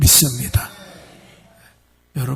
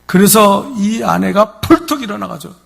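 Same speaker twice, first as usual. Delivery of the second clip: usual speaking voice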